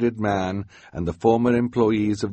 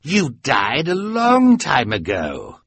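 slow villain voice; lightly voiced villain; sly Olaf; 6 semitones higher, 5 dB louder, slower than usual. A narrator's reading. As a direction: accuse the boy. Olaf accuses.

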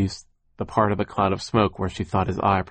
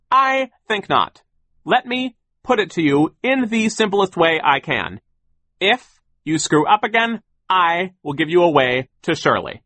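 high, loud, deliberate threat; pompous boss laying down an ultimatum; overbearing boss; 10 semitones higher, 6 dB louder than usual. A narrator's reading. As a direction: spell out the threat emphatically. Sir threatens children.

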